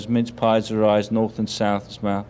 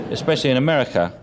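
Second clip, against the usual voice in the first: ordinary talk, nothing marked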